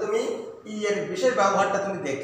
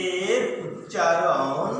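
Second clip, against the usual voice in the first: slow emphasis; 4 semitones lower, much slower than usual. Shown as a demonstration. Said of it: "teacher slows for emphasis".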